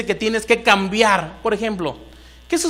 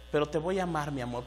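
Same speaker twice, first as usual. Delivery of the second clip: low, soft husband's endearment; acted tenderness; 5 semitones lower, 10 dB quieter, faster than usual. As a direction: drop to a tender hush for your wife. Husband's acted words to wife. (ternura actuada, en voz baja y suave)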